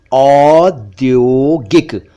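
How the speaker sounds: "औद्योगिक" stretched very slowly, open au, then closed o. Shown as a demonstration. Teacher shows both sounds, au then o, slowly. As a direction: draw out "औद्योगिक" as "awd-yoh-gik". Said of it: "इसमें पहले औ sound है".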